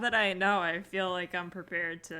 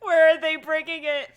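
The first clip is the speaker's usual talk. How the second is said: laughing mock panic; 7 semitones higher, 10 dB louder than usual.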